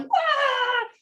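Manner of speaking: high-pitched imitation meltdown wail; drawn-out high "wah" yell; mock yelling wail. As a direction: wail like a body in full tantrum. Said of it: "I'm still yelling".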